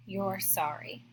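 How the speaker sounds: quiet, slow sincere emphasis; sincere and soft. Real, not acted. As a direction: let it land gently, you mean it. Stressing meaning the apology.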